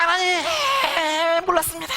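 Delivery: weeping confession to God; crying. Retold or imitated. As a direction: confess through sobs. Retold crying before God.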